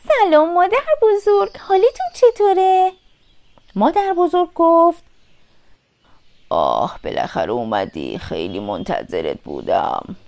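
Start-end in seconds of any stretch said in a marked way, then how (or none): 0.1-2.9 put-on high-pitched little girl voice; Little Red greeting. A narrator's reading. Little Red enters the cottage, high greeting. 6.5-10.1 very deep, quiet wolf voice; wolf posing as grandmother. A narrator's reading. Disguised wolf welcomes Little Red, deep odd voice. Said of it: "صدای مادر بزرگ با همیشه فرق داشت".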